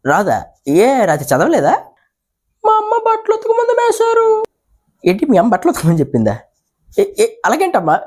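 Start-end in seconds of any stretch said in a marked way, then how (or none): 2.6-4.4 put-on high girlish whine; high schoolgirl voice. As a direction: plead to sir like a little girl. Schoolgirl gives excuse.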